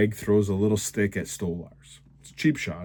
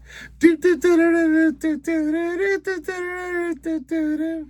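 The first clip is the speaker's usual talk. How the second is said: rapid high "doo-doo-diddle" vocal run; high nonsense trilling; 4 dB louder than usual. Real, not acted. Giddy at Scotty losing a fight, high silly trilling. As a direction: rattle off silly high-pitched syllables. Wordless rattled-off noise while laughing.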